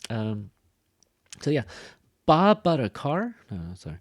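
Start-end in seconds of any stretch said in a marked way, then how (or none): 2.2-3.3 loud, high disbelief from "Bob" to "car"; big incredulous echo question; exaggerated surprised question. Shown as a demonstration. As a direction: overdo the shock, swooping up.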